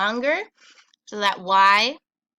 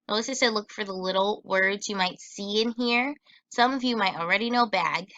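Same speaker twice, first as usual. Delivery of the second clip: usual speaking voice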